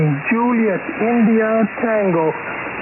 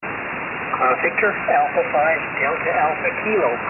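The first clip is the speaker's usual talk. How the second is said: over a radio; 3 semitones lower than usual. Real, not acted. Check-in heard over ham radio.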